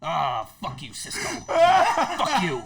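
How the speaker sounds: mock-offended curse bursting loud; Rick impression. Rick snapping at Cisco over his blunt, impression. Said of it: "He's like".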